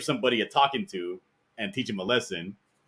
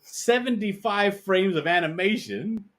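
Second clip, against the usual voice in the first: much higher, huffy offended artist; mock indignation; 11 semitones higher, 5 dB louder than usual. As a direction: play the wounded artist, hammed-up.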